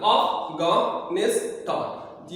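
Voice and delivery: Afghanistan very slowly, in four separate beats; syllable by syllable. Shown as a demonstration. Teacher counting syllables in Afghanistan, slowly divided. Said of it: "इसमें चार syllable है".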